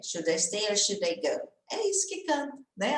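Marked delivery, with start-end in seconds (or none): 0.0-1.5 whole question linked without pauses; words run together. Shown as a demonstration. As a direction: say the refrain in one connected go. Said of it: "A hora que junta tudo".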